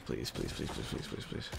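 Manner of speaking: fast, whispered string of pleas; whispered begging. Real, not acted. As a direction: beg under your breath while hoping. Begging under breath for the win.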